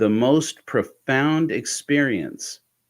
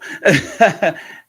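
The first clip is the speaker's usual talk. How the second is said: high bright burst of laughter; real laugh; 10 semitones higher, 6 dB louder than usual. Real, not acted. Laughing at being left to trip alone. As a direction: laugh out loud at the memory.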